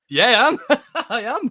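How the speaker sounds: high, loud, through real laughter; laughing reply. Real, not acted. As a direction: crack up while answering the caller. Teased, laughs while answering caller.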